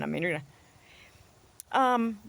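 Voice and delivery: normal talking voice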